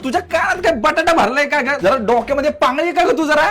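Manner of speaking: usual speaking voice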